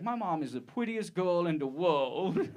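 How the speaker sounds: put-on R's turned to W's in "prettiest girl in the world"; childish R impediment. Little kid praising his mom, R's softened to W's in "prettiest girl". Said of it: "I couldn't say words with R's in them".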